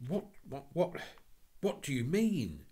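high, loud flustered sputter; boy flustered at the policeman, high and loud; flustered stammer. Acted. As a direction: stall the policeman in a panic.

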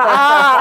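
loud, exaggerated "aah" of weeping; hammed-up crying cry; mock crying wail. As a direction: imitate bawling out loud. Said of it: "Nangis lah".

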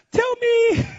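very high, drawn-out wail; mock crying. Crying husband begging wife to tell him, high mock wail. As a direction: imitate begging through tears. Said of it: "I'm crying".

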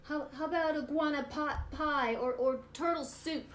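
high, loud and flustered; panicked pig. Acted. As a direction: stammer back in alarm. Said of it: "Pig gasped".

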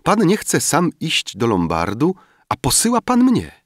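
put-on high, rising girlish reproach; young girl's naive rebuke; reproachful girl. A narrator's reading. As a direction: tease him with childish logic. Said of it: "rzekła".

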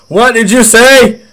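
loud, high, slow furious yell imitating the old man; angry old customer. Old customer yelling at the worker who insulted him, loud high furious yell. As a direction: bellow it like an outraged old guy threatening.

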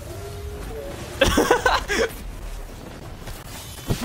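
breathy high-pitched giggling fit; high laugh. Real, not acted. Laughing while stealing a kill.

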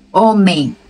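ordinary talk

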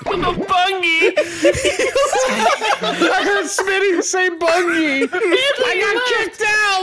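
cry-laughing helplessly; laughing fit. Real, not acted. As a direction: barely get words out through laughing. Laughing fit over spelling.

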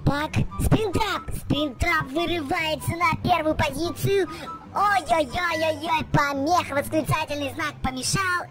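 fast, high play-by-play; excited race commentary. Acted. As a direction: call the race live.

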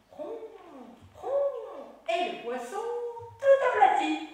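put-on high, loud singsong for children; reciting a nursery rhyme. Performs a gesture rhyme.

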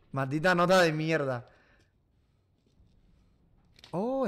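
normal talking voice

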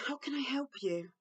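put-on soft, tender question to a child; gentle offer of help. Shown as a demonstration. Models the gentle replacement phrase. (pergunta suave e acolhedora)

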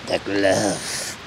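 very low, soft, drawn-out voice; hammed-up catchphrase. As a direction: sink it low and draw it out.

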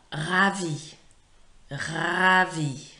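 "ravi" loud and stretched, ending on a long "ee"; model pronunciation. Shown as a demonstration. Teacher modelling "ravi" for pupils to repeat, loud drawn-out pronunciation. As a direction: belt out "ravi" slowly for copying. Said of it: "repeating after me".

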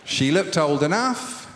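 drawn-out bingo call; bingo caller. Acted. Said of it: "update the bingo calling".

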